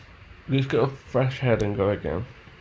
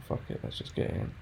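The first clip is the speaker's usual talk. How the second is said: whispered inner thought; 10 dB quieter, faster than usual. Retold or imitated. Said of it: "I just thought".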